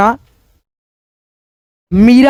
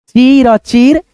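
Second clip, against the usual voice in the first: slow clear reading; much slower than usual. Shown as a demonstration. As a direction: say चीर aloud slowly, again and again. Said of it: "ऐसे बोलना". (धीरे और ज़ोर से बोलना)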